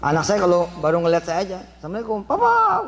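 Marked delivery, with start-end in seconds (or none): 1.8-2.8 put-on high, sweet child's voice; high sing-song child greeting papa. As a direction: chirp it like a little kid greeting dad.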